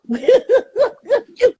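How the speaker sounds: squealing giggle while tickled; ticklish laughter. Real, not acted. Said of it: "you need to stop tickling me".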